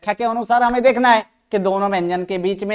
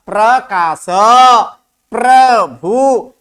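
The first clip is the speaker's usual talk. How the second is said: drawn-out modelling; 6 dB louder, much slower than usual. Shown as a demonstration. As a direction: stretch each syllable slowly. Models example words for class. (शब्द खींच-खींचकर बोलना)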